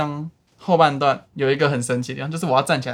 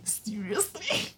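incredulous; 11 semitones higher than usual. Retold or imitated. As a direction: act out shocked disbelief. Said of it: "我就一直在后面".

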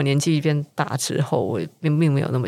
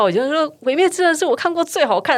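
gushing casual fan; 12 semitones higher, 3 dB louder than usual. Retold or imitated. Voicing a casual fan's claim.

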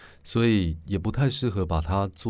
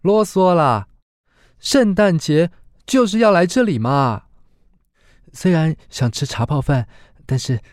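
much higher, loud playful woman's retort; sister brushing off teasing; 9 semitones higher, 9 dB louder than usual. Acted. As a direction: wave off the teasing with a pout. (姐妹娇嗔回嘴)

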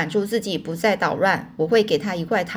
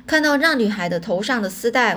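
ordinary delivery

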